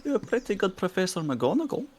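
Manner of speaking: high, swooping voice impression; Professor McGonagall. Friend showing off accents in the chat, McGonagall impression. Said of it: "[name]'s pretty damn good at 'em".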